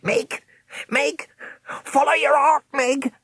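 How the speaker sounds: very high, loud parrot squawk; parrot croak. A narrator's reading. Old man croaks to Meg like a parrot. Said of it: "croaked amazingly like the parrot".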